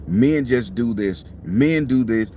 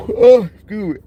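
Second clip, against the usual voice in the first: yawning through the words; stretched yawn, real and tired; 10 semitones higher, 6 dB louder than usual. Real, not acted. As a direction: say it mid-yawn. Yawning mid-sentence while driving.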